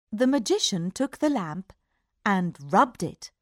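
ordinary delivery

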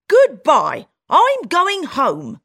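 loud, then slow and final; firm goodbye. Acted. Country mouse leaving the town house, loud firm goodbye. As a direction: say it and leave. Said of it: "she says".

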